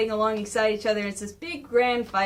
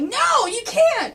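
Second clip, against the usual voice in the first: high, loud, drawn-out protest; Batman's desperate shout; 11 semitones higher, 5 dB louder, slower than usual. Retold or imitated. Batman as Shredder escapes, loud desperate shout. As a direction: cry out long and high. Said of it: "Batman's going like".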